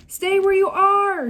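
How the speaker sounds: high loud shout to stop her. A narrator's reading. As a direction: yell at her to stay put. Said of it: "Jack shouted".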